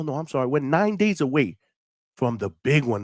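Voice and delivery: usual speaking voice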